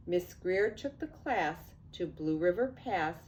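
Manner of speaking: ordinary delivery, nothing marked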